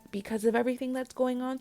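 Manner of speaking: ordinary delivery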